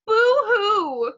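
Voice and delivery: mock crying. Little Cletus pretending to weep over child labor laws, fake sobbing. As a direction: ham up a child's fake cry.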